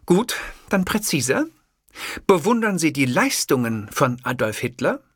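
impatient reporter, "bewundern" weighted; pressing journalist. Acted. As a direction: push the question hard, leaning on "bewundern". Journalist interviewer voiced in story.

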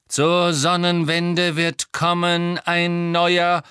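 ghostly seer. A narrator's reading. Old man's figure rising from shards, prophesying. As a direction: intone it word by word, held high.

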